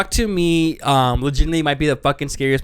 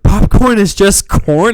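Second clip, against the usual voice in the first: slow, louder, laughing echo; emphatic slow echo; 6 semitones higher, 8 dB louder, slower than usual. Acted. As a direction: repeat it like a catchphrase. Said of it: "Like a new meme".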